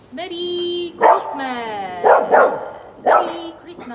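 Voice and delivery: loud, high, stretched holiday greeting; cheery greeting. Real, not acted. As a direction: sing out the wishes. Greeting viewers excitedly.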